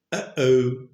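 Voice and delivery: "Uh-oh" with a clean glottal catch in the middle; glottal stop. Shown as a demonstration. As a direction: stop the breath sharply between Uh and oh. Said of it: "যখন আমি বলেছি, তখন কিন্তু একটা গ্লটাল stop ব্যবহার করেছি". (গ্লটাল stop দিয়ে "Uh-oh")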